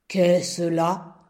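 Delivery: a hollow, booming rock voice; cavernous, echoing rock. A narrator's reading. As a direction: speak like a great stone waking up. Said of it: "hurla-t-il d'une voix caverneuse".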